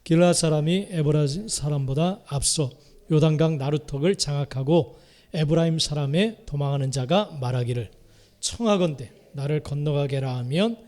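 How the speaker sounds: ordinary talk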